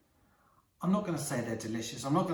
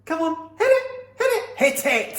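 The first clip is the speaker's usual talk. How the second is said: excited urging; 13 semitones higher, 9 dB louder than usual. Acted. Urging viewers to hit bell.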